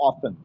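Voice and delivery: "often" with no t sound; silent t. Shown as a demonstration. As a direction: say "often" as "offen". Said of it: "त का उच्चारण नहीं होता". ("often" को त के बिना "ऑफन" बोलें)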